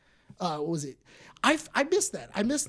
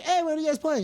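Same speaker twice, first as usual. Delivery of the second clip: higher-pitched, chirpy hail; casual greeting; 9 semitones higher than usual. Retold or imitated. Greeting friends in Xbox chat, casual and upbeat. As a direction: pop into the chat and say hi.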